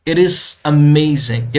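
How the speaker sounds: "it is amazing" drawn out, falling at the end; slow down and let "amazing" sink; slow falling finish. Shown as a demonstration. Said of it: "pitch है वो down आती है".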